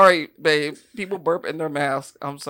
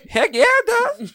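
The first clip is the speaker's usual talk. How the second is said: high, slow, laughing fake enthusiasm; eager mock quote; 6 dB louder than usual. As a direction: ham up a cheerful yes. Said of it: "He's like".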